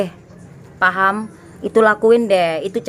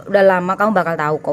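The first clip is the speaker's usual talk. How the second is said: usual speaking voice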